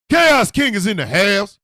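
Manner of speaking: booming high announcer yell to the room; hype shout. Real, not acted. A comic calling to the crowd in a booming hype shout.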